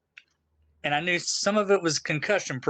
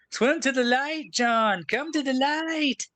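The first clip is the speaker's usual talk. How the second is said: beckoning voice; 9 semitones higher, slower than usual. Pretend. Jokingly beckoning caller toward light.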